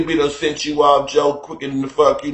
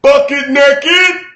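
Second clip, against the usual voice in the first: high, loud, stretched-out holler; mimicked comic yell, drawn out; yelled catchphrase; 12 semitones higher, 7 dB louder, much slower than usual. Quoting a comedian's yelled catchphrase.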